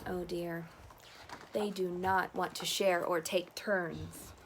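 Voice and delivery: usual speaking voice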